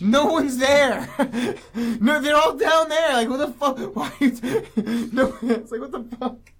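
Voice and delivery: real laughter, slow at first, then breaking up; laughing. Real, not acted. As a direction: crack up mid-sentence, barely getting words out. Cracking up at the scene.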